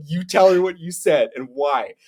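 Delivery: a touch of a giggling, stern father; dad making his son confess; teasing dad. Retold or imitated. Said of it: "and he's like".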